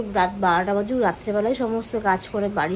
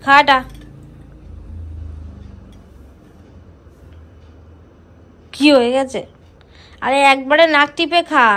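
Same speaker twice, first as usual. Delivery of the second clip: loud, higher insistent urging; 4 semitones higher, 6 dB louder than usual. Real, not acted. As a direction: push her loudly to just drink it. Urging sister to drink. (জোরে তাগাদা দিয়ে)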